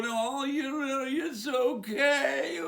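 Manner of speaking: high, drawn-out syrupy mock reassurance; mocking coo. Teacher telling little kids in school, mocking coo. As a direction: coo it slowly, hammed-up and high.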